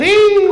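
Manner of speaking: very high shocked squeal "ee"; old lady's shocked squeal. Acted. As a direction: squeal like a startled old woman.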